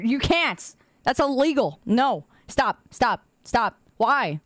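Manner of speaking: usual speaking voice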